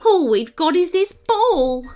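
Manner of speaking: a gentle, high little animal explaining its loss; quokka voice. A narrator's reading. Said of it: "and the quokka said".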